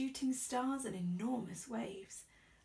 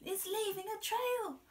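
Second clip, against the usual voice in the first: put-on much higher, louder child cry; excited children; 10 semitones higher, 4 dB louder than usual. A narrator's reading. Children spotting the snail, excited cry. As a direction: point it out excitedly. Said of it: "say the children".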